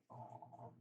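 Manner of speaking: soft breathy whisper over a purr; fond unvoiced whisper. Real, not acted. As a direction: hush it so the cat keeps purring. Whispering over purring cat.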